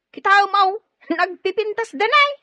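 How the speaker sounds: high, loud delighted gush; excited admiring. Acted. Maid gushing over the clothes Sir Eduardo bought.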